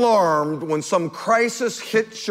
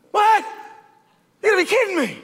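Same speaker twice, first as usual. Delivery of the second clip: higher, disbelieving outburst; stunned disbelief; 12 semitones higher, much faster than usual. Retold or imitated. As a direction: react to an impossible price tag. Reenacts reaction to cost.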